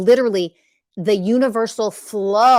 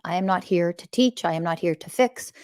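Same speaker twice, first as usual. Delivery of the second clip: ordinary delivery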